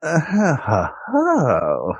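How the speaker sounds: reluctant, forced laugh trailing into an "oh"; disdainful laugh. Real, not acted. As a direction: laugh as if you really don't want to. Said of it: "That was such a disdainful and, uh, unwilling laugh".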